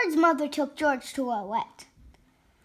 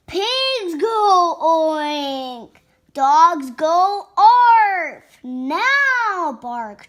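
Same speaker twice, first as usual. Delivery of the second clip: very slow, loud, stretched scolding; mad mother; 6 dB louder, much slower than usual. Acted. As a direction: drag out each animal sound in exasperation. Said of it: "She's getting more mad".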